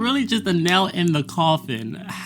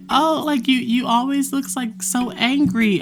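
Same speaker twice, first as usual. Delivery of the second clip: much higher, mocking the teacher's whine; mock professor voice; 8 semitones higher than usual. Student mimics professor calling her angry, in a high mocking voice. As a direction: imitate her condescending teacher in a high put-on voice. Professor quote in retold classroom story.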